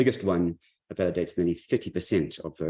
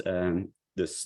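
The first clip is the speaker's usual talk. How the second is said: usual speaking voice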